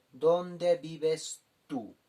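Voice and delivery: very slow, clear Spanish question; teacher summing up slowly; slow summary gloss. Shown as a demonstration. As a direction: lay out the full meaning slowly. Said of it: "Kaukansa, ¿dónde?".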